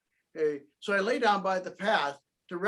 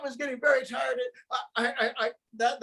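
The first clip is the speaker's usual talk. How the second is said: usual speaking voice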